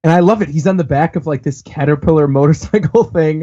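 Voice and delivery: usual speaking voice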